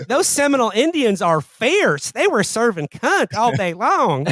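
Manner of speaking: high, slow, sassy drawl. Pretend. Mimicking a Ryan Murphy show actor, high and sassy. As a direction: serve it slowly with sass. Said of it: "doing that dude from all the Ryan Murphy shows".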